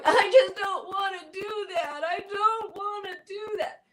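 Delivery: put-on high whiny kid refusing; whiny child. Kid refusing parents, high whiny child voice. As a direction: whine it like a stubborn little kid.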